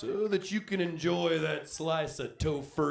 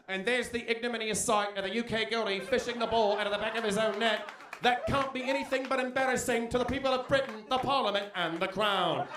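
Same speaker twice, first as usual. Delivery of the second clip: put-on higher, fast British announcer accent; 5 semitones higher, faster than usual. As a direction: read it like a posh British commentator. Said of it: "They'll be like".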